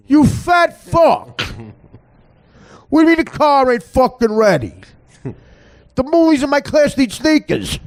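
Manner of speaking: high, loud tough-guy Jersey screaming; Andrew Dice Clay yell. Retold or imitated. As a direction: bark it like a foul-mouthed brawler chewing someone out. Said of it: "she sounded like Andrew Dice Clay".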